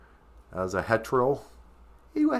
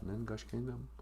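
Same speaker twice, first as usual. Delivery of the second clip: ordinary talk, nothing marked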